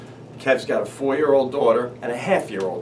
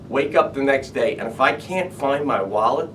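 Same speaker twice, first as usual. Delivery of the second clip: ordinary talk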